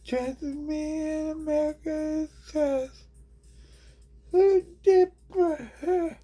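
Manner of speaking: very high, odd squeaky rambling; squeaky character voice. Acted. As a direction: ramble in a shrill cartoon voice.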